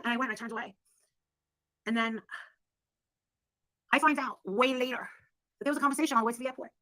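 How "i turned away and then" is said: ordinary talk, nothing marked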